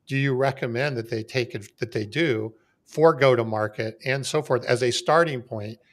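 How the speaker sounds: usual speaking voice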